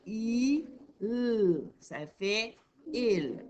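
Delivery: slow, letter by letter, "I", "L", then "il"; sounding out slowly. Shown as a demonstration. Teacher sounding out "il" slowly for her class. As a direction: spell out each sound for a child. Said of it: "si tu fais comme dans la lecture à deux".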